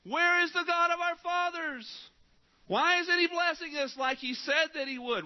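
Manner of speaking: high, slow, pleading wail; crying out to God. Retold or imitated. Israelites crying out to the Lord, a high slow plea. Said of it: "They cried out to the Lord".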